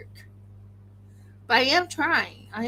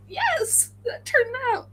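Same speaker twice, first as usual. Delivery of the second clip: excited; 14 semitones higher, slower than usual. Retold or imitated. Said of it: "I'm like".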